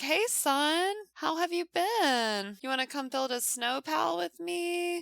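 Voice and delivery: high sing-song mom asking her son to play; sweet mom voice. Acted. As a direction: coax the kid like a doting mom.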